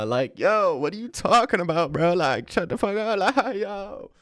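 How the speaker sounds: high put-on voice, exasperated; hammed-up exasperation in a high voice; mock outburst. Streamer ranting at chat in a high, hammed-up voice.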